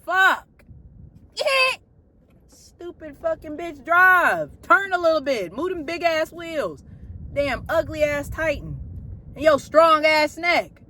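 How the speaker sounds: furious heckling from behind the wheel; real road rage. Real, not acted. Yelling at a truck while driving.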